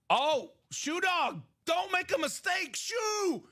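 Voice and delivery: high, stretched-out mock alarm; playful calling back. Host warns Shoe Dog off a mistake, playful high calling. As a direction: call after him like a runaway dog.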